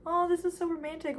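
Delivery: high, mock-swooning delivery; hammed-up romantic gush. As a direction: imitate a girl swooning over romance. Voicing her gushing over photos.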